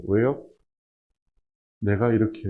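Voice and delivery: usual speaking voice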